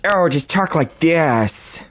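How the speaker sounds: sloppy imitation of how others do her; lazy impression. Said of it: "everybody just goes".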